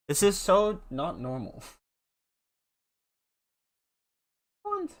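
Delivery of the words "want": very high squeak at the cat; high cooing pet voice; squeaky pet voice. Acted. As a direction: squeak it like baby talk to a pet. Mocking the cat's want.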